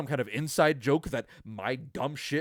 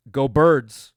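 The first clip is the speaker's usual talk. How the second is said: loud sign-off cheer; loud team cheer to sign off; 2 semitones higher, 16 dB louder than usual. Real, not acted. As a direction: shout it out for the team. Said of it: "signing off cheer".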